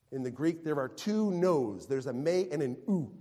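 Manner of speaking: usual speaking voice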